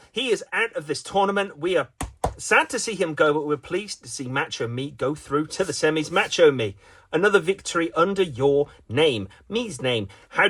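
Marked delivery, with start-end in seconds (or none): none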